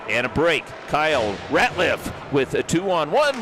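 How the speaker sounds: brisk hockey commentator calling the action; play-by-play. Acted. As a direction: call the game live, rink announcer energy.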